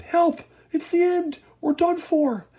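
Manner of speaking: high, loud panicked cries; terrified shout. A narrator's reading. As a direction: scream for help. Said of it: "Grandpa Joe shouted".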